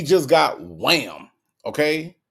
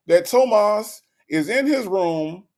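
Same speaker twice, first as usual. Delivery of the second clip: usual speaking voice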